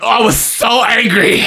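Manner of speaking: high, hammed-up indignation; mock angry outburst. Telling friends how angry she was, hammed-up outburst. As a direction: burst out in mock fury, nearly laughing. Acting out her childhood anger.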